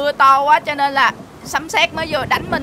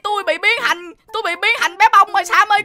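higher, raised, urgent calls; pleading cry for help; 7 semitones higher, 5 dB louder than usual. Acted. Swapped man at Sam's door, pleading loudly. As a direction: call through a closed door.

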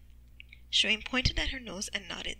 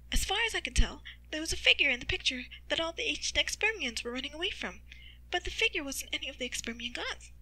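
higher-pitched mother's voice; explaining mother; 6 semitones higher than usual. Acted. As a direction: explain it in a raised character voice. Mother answers in narrated story.

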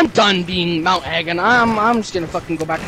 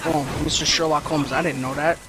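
sarcastic; 5 semitones lower, 5 dB quieter than usual. Real, not acted. Sarcastic reply to teammate.

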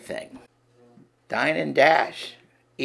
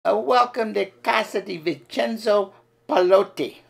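put-on higher, louder Italian-accented waiter; a hammed-up Italian accent, serving with gusto; Luigi Italian accent; 6 semitones higher, 4 dB louder than usual. Waiter Luigi welcoming diners in an Italian accent. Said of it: "Listen and learn".

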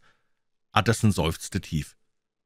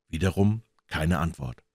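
ordinary delivery, nothing marked

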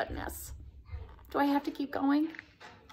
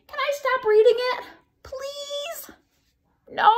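pleading; 11 semitones higher, 3 dB louder than usual. Real, not acted. Begging kids to stop.

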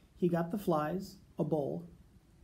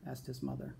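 usual speaking voice

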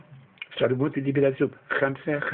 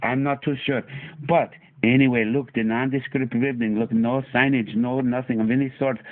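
put-on Afghan accent; 2 semitones lower than usual. Comedy vlog held in put-on accent.

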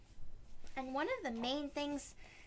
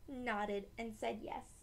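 usual speaking voice

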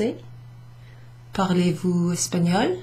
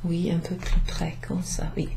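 whisper; 7 dB quieter than usual. Real, not acted. Moving close to the recorder.

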